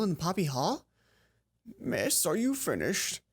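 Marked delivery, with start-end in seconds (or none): 1.8-3.2 slow, lightly voiced impatient teacher; teacher's voice. Teacher waits for student in clubroom, slow and dry. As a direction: draw it out like a waiting teacher.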